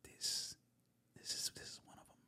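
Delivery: hushed inner voice; whispering. Retold or imitated. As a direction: whisper it to yourself. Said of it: "unvoiced whisper".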